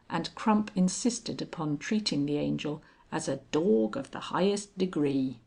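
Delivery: usual speaking voice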